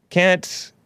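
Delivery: normal talking voice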